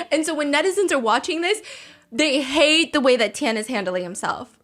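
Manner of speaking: ordinary delivery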